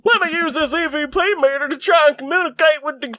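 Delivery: put-on Southern trucker accent, yelling over a haunted truck; Southern trucker accent at full throttle; hammed-up Southern trucker accent. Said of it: "Is it bad that I can do that accent?".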